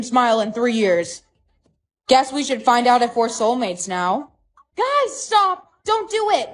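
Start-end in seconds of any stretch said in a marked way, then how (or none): 4.8-6.5 high-pitched, alarmed cry to stop; urgent, much higher voice; urgent plea. Acted. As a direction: call out to head them off. Friend urgently trying to stop them.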